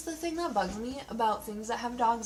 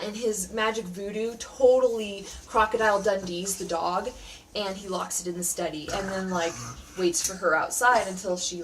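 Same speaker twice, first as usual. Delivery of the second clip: ordinary talk, nothing marked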